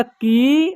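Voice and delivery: की with a long, held ee; drawn-out vowel. Shown as a demonstration. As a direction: hold the vowel in की. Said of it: "यानी कुछ वक्त तक होगा".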